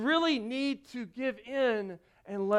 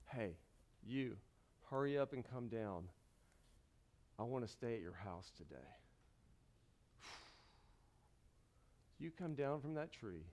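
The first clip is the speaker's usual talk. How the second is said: softer, lower, tender call; gentle Jesus voice; 9 semitones lower, 15 dB quieter than usual. Acted. Jesus gently calling Zacchaeus down from the tree, soft and low. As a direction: call him down kindly.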